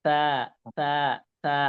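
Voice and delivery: repeated তা, soft and light, tongue tip on the teeth; teacher modelling the soft Arabic ta. Shown as a demonstration. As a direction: tap out তা gently three times. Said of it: "নরম করে আলতো করে বলতে হবে".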